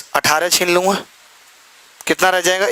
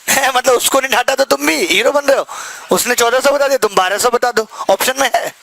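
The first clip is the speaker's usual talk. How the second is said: mock teasing; 7 semitones higher than usual. Teacher mocks a student who guesses answers, higher teasing tone. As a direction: tease the kid who copies guesses.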